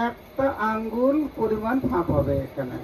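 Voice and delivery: normal talking voice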